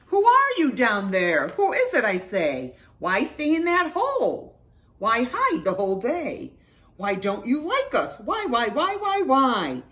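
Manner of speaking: loud, high, pressing questions; insistent questioning. A narrator's reading. Animals question the one hiding in hole, insistent. Animals badger the hidden creature.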